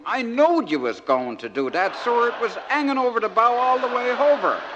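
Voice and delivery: high, loud know-it-all punchline; loud naive exclamation. Acted. George to the captain at anchor, loudly sure of himself. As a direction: build to a loud, high finish.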